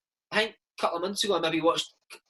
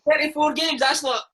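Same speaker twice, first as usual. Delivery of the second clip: laughing protest; 7 semitones higher, 3 dB louder than usual. Real, not acted.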